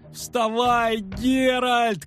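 a bard shouting in a cloud of flour. Acted. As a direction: yell at him to get up. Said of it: "кричал Трубадур".

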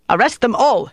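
officer barking an order in annoyed frustration; petulant anger. A narrator's reading. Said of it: "angry frustration injected a petulant note".

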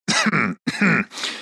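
quiet rising throat clear. Acted. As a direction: clear your throat before speaking. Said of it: "откашлялся".

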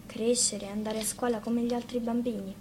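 ordinary delivery

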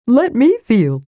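eager kender asking to touch. A narrator's reading. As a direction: jump in eagerly like a curious kender. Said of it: "said Tass eagerly".

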